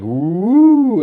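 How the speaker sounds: high, loud rising whoop; mimicked "woo". Trader retelling his reaction to the drop, loud high woo. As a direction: let out a long swooping woo.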